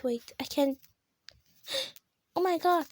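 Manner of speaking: ordinary talk, nothing marked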